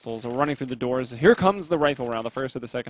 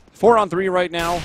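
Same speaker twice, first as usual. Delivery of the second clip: louder, higher play-by-play burst; excited call; 6 semitones higher, 10 dB louder than usual. Real, not acted. Esports caster calling a four on three, excited. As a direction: punch up the live call. Caster calls a sudden man advantage.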